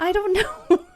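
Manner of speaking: high, loud, laughing wail; laughing, exasperated cry; helpless laughter. Real, not acted. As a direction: break into laughter while admitting you're stumped. Laughing helplessly when pressed for reasons.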